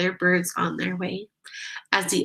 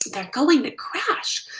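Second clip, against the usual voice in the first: gasp; 7 semitones higher than usual. A narrator's reading. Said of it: "gasped Stella Luna".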